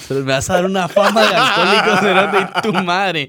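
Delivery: indignant but laughing hard; laughing protest. Real, not acted. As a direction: curse your friend while cracking up. Laughing protest at friend's joke.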